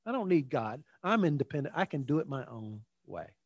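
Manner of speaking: low, soft dismissive mimicry; self-reliant refusal. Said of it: "How many times have you heard that?".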